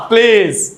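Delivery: প্লিজ with a big puffed P; blast of air. Shown as a demonstration. Teacher jokes about blowing listeners away, প্লিজ heavily aspirated. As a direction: burst the P of প্লিজ hard and loud. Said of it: "সামনের মানুষকে উড়াইয়া দিবেন না".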